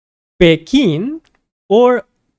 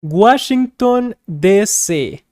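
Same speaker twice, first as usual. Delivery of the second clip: Spanish accent; 3 semitones higher, slower than usual. Shown as a demonstration. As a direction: say "Washington" like a Spanish speaker, a bit slowed. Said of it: "we kind of use a Spanish accent".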